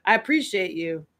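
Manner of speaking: ordinary delivery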